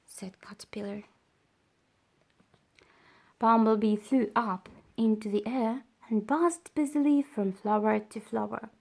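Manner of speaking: ordinary delivery, nothing marked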